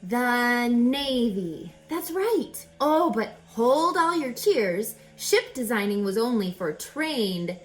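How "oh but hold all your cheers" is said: ordinary delivery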